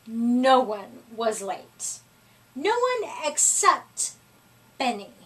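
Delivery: usual speaking voice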